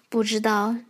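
ordinary delivery

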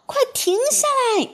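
high, anxious yell; urgent shout. A narrator's reading. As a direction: shout for it to stop now. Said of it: "着急地大喊".

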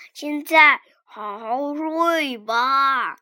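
slow gentle goodnight; soothing. Acted. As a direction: lull the cub to sleep. Father bear soothing.

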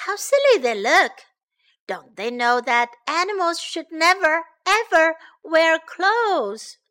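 high, loud, emphatic disbelief; scornful amusement. Acted. Hedgehog watches animals in clothes, emphatically scoffing.

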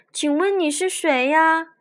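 high, puzzled astonished question; surprised. A narrator's reading. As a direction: ask in wonder and doubt. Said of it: "惊讶疑惑地问着".

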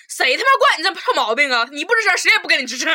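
loud, exasperated scolding; indignant rant. Retold or imitated. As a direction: scold her loudly. Venting about her sulking. (愤愤不平地大声数落)

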